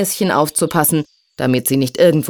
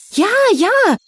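very high, excited yes; eager cry. A narrator's reading. Both fairies answer the snake at once, eagerly calling. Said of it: "riefen Silja und Fari fast gleichzeitig".

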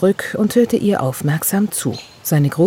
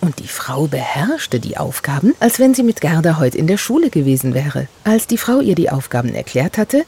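ordinary delivery

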